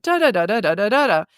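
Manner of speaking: da-da syllables tracing a bouncy pitch line; da-da tune. Shown as a demonstration. Coach mimicking a client's greeting melody on da. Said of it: "you had very nice tonality".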